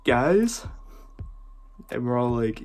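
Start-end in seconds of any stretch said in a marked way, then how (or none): 0.1-0.6 higher, rising questioning call; retold yell. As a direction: call out as if alarming your roommates, pitch climbing. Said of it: "and he yells".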